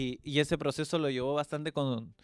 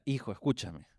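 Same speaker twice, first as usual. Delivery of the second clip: tender serious talk; 2 semitones lower, 3 dB quieter than usual. Retold or imitated. Father speaking seriously to son.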